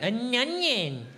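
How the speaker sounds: slow, high, whiny mangled "onion". Shown as a demonstration. Comic mocking his own word, onion drawn out high and mangled. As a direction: drag "onion" out into a nasal nyun-yin. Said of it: "can't even say an onion".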